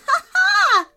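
loud, high burst of joyful laughter; delighted laugh. A narrator's reading. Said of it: "she laughed".

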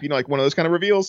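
usual speaking voice

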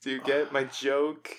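ordinary delivery

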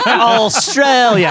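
hammed-up Australian accent; bad Australian accent. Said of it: "I do the best Australian accent".

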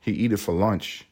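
ordinary talk

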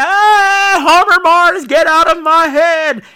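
high, loud, slowed cry at the earworm; mock exasperated yell. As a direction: shout it up high, begging the song to leave. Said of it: "And I was like".

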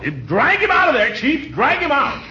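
shouting. Acted. Yelling to chief.

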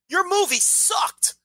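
a lightly put-on rude fan; mock insult. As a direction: drag out the insult in a silly voice.

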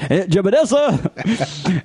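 slow, drawn-out cry of the name; high, slow villain yell; evil farmer call. Retold or imitated. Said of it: "He says".

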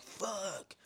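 high-pitched mock cursing; hammed-up cry of frustration. Acts out villains' frustrated outburst.

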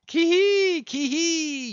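very high, loud wild whoop; shrieked rower's battle cry; yelled war cry. A narrator's reading. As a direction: yell it out high with a long held ending. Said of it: "yelled Daggoo".

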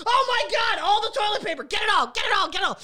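panicked hoarder grabbing toilet paper; mock panicked shopper. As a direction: shout it like grabbing the last rolls.

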